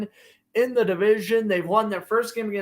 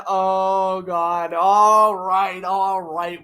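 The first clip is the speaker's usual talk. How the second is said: ordinary talk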